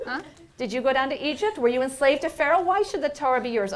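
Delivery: high, loud mocking challenge to the angels; taunting Moses. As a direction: rub their noses in it.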